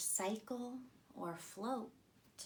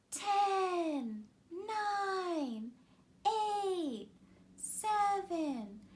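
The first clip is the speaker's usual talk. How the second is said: higher and louder, building to liftoff; launch countdown; 9 semitones higher, 4 dB louder, much slower than usual. A narrator's reading. A countdown for the moon rocket launch, rising excitement. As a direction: count down like mission control, climbing to a shout.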